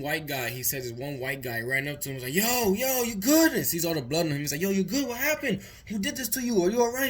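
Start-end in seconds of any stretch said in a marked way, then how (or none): 2.3-3.5 much higher, urgent rising check-in; passerby asking a bloodied guy if he's okay; concerned stranger. Retold or imitated. Stranger runs up to injured friend. 4.6-7.1 much higher, rapid rising questions; passerby anxiously questioning a bloodied young man; alarmed stranger. Retold or imitated.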